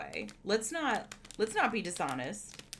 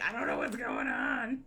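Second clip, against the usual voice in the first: much lower mock-dopey cat voice; cat's inner voice. Owner voicing her spooked cat, in a low goofy voice. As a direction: voice the confused cat, deep and dumb. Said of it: "she's like".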